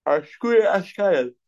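high, slow mocking drawl; sarcastic woman. Woman teasing the man over the wine, sarcastic. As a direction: toss it off as a sarcastic brush-off. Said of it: "assume that she's being sarcastic".

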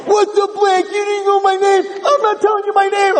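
high, hostile retort; the girl refusing to give the rabbi her name, angry; angry teen. Retold or imitated. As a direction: fire it back at him, voice up high. Girl snaps back at rabbi.

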